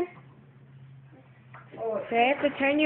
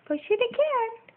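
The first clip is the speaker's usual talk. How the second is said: much higher, lilting encouragement; high sing-song coaxing; 11 semitones higher than usual. Real, not acted. Parent coaxing baby to push the button again, high sing-song. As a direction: sing it up to coax her.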